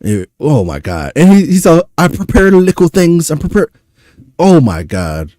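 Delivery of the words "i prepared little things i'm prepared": mocking Toronto accent, pitched higher; hammed-up Canadian accent mimicry. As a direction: imitate a Toronto patois-tinged accent, louder. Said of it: "this Canadian accent shit".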